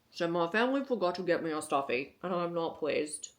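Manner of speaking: a mild hint of a sulky pet complaining; dog's voice. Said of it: "That's how he talks".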